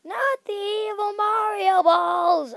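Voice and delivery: high, loud, slow mock wail; hammed-up terror. Gamer dodging the evil Mario balls, mock terrified wail. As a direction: plead in over-the-top horror.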